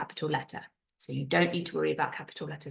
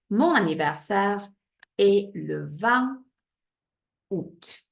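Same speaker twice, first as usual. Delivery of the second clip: slow model sentence; 3 semitones higher, 4 dB louder, slower than usual. Shown as a demonstration. Teacher building the sentence for pupils, slow chunks. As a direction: spell out "Mon anniversaire est le vingt août" chunk by chunk. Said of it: "Don't forget your little accent".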